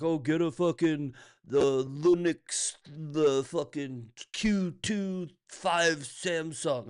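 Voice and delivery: lightly voiced pushy friend, drawn out; mocking friend voice. Streamer mimics friends recommending other computers, slow and mocking. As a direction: mimic a know-it-all friend pushing a Samsung, slow. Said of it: "They're like".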